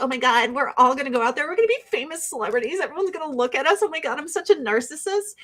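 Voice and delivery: fast, gushing mockery; fast giddy impression; mock excited moms. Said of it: "they're like".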